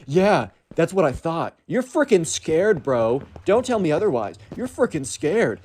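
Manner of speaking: slow, high, drawn-out jeer; mock taunting teen. Emo kid taunting Hank in front of friends, slow and jeering. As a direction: jeer at him like a cocky kid.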